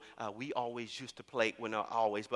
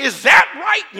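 high, loud mock drill-sergeant challenge; drill instructor yelling; 13 semitones higher, 20 dB louder, much slower than usual. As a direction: bark it at the recruit who looked. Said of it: "I'm going off on him".

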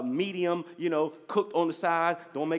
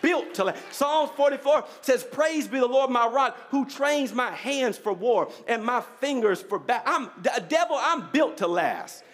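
preaching that climbs higher toward a peak; impassioned preaching; 6 semitones higher, 3 dB louder than usual. Real, not acted. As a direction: build to a rousing declaration for the congregation. Preaches at rising force.

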